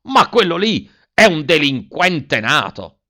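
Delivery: high, loud, slow indignant outburst; outraged exclamation. A narrator's reading. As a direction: explode with scandalized indignation. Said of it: "esclamò al colmo dello sdegno".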